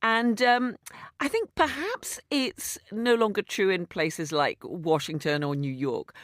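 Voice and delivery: ordinary talk, nothing marked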